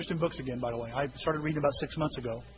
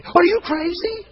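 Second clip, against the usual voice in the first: high, louder, slow aghast outburst; incredulous; 13 semitones higher, 9 dB louder, slower than usual. Retold or imitated. Young Tim reacting to Susan's fasting idea, high incredulous outburst. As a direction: let it burst out in disbelief.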